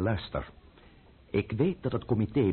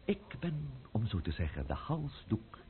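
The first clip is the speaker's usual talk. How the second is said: hushed and grim; 3 semitones higher, 10 dB quieter than usual. Acted. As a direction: drop to a hush, menacing. Grim boast after outburst.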